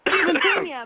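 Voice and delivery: high, loud shout-out; excited hype. Real, not acted. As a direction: hype up the name. Hyping a friend's name.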